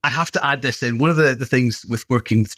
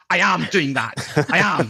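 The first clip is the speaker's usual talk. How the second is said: higher-pitched mock-indignant comeback; wife's retort; 5 semitones higher than usual. Husband imitating wife's reply to his squat cues, higher protesting voice. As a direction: imitate an exasperated wife insisting, pitched up. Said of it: "her reply would be".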